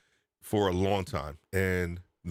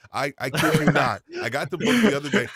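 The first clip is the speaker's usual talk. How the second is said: louder and higher with excitement; fast and excited; 6 semitones higher, 7 dB louder than usual. Real, not acted. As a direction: rush it out, thrilled. Excitedly explaining finding the book.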